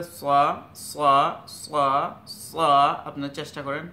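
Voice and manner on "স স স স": "স" as a full, heavy Arabic sad; heavy s sound. Shown as a demonstration. Teacher modelling for learners to try, heavy "স". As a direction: repeat "স" heavily, each one separate. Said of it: "এটা একটু ভারীভাবে".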